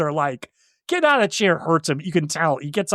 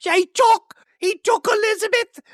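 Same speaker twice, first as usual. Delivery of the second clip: panicked Minnie. Retold or imitated. Host imitating the maid panicking about the abduction, very high. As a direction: squeal it in a panic. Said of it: "Minnie's like".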